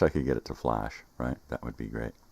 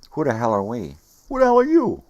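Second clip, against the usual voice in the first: building to a high yell; rising shout; 10 semitones higher, 12 dB louder than usual. Real, not acted. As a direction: escalate into a shout. Building existential rant, shouting.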